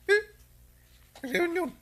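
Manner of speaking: sharp high startled echo; surprised question. Acted. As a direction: catch the word in disbelief. Hears about a family reunion. (nasdaaw a panagsaludsod)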